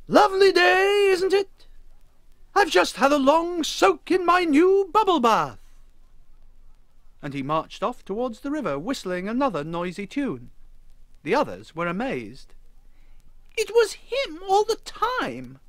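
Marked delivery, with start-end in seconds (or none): 0.1-5.6 high, booming, cheerfully oblivious. A narrator's reading. Wally chats to the gathered crowd, booming. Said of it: "Wally boomed". 13.6-15.6 high, slow amazed gasp. A narrator's reading. Lucy Lemon realises who made the noise, gasping. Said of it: "gasped Lucy Lemon".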